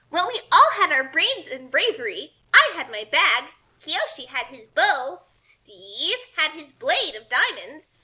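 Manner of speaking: loud, gloating villain monologue; ranting like a villain. Acted. Kasumi gloating to the book leader, loud villain rant. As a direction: gloat as if revealing the master plan. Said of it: "ranted like a painfully non-genre savvy villain".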